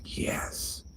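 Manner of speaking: a breathy whispered Yes; blissful whisper. Retold or imitated. Recalling the last jail hours, a blissful whisper. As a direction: whisper it in pure relief. Blissful inner reaction in heaven.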